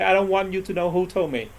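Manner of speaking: usual speaking voice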